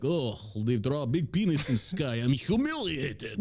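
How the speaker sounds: hammed-up Russian accent, slow and shouted at the end; mock Russian accent. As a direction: play a huffy Russian in broken English. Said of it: "I don't see how Russians would be like".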